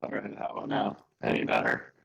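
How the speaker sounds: robotic cutting-out voice; call dropping out. Real, not acted. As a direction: still glitchy and broken up.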